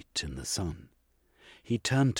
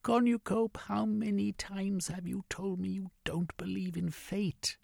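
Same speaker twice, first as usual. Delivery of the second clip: higher genteel lady voice; woman's voice; 14 semitones higher than usual. Acted. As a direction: voice her high and prim. Female character voice.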